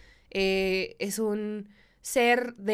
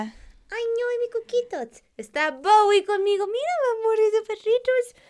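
high cooing pet voice; baby talk to a dog; 11 semitones higher than usual. Acted. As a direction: coo at a puppy, high-pitched.

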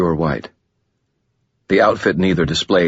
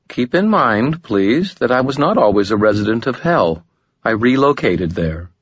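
lightly voiced woman, higher, smooth and composed; a hint of a calm, alluring woman, higher; Lashiel, gracious demon; 6 semitones higher than usual.

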